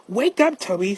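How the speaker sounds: high, loud wake-up call; rousing call. Acted. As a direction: call out brightly to rouse a sleeper. Driver rouses Toby with a call.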